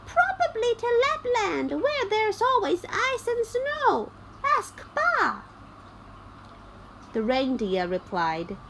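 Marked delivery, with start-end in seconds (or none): 0.1-5.4 lightly voiced high girl; a hint of the bossy robber girl. A narrator's reading. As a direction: pitch up for the little robber girl.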